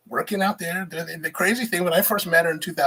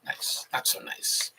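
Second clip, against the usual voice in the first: whisper; 8 dB quieter, slower than usual. Shown as a demonstration. Whispered reading of a phrase.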